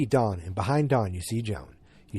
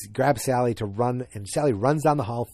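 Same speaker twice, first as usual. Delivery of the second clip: ordinary delivery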